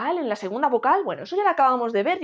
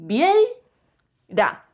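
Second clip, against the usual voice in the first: "vieira" split as viei, pause, ra; "vieira" in two detached, emphatic beats; syllable by syllable; 2 semitones higher, 4 dB louder than usual. Shown as a demonstration. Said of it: "Si separamos esta palabra en sílabas".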